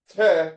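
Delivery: "te" as one short syllable, high and loud; demonstrated syllable. Shown as a demonstration. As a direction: punch out "te" on its own.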